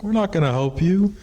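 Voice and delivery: high, loud scolding refusal; scolding thunderbirds. Retold or imitated. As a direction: tell him off from above. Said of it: "they're like".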